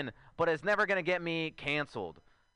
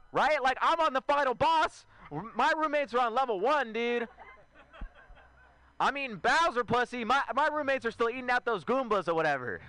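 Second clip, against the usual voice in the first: higher, cocky boasting rush; a gamer bragging to friends, hyped and cocky; 8 semitones higher than usual. Pretend. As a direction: crow about your conquest like a gamer. Bragging about dating older women.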